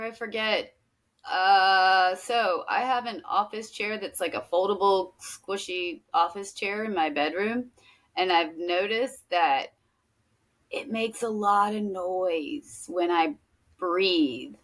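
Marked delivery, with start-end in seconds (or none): none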